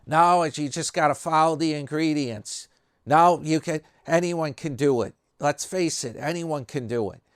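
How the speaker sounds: busting on him. Retold or imitated. Cal Pote scoffs at Stu's talk of chef talent, mock dismissive. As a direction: imitate him needling a would-be chef. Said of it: "like, busting on him".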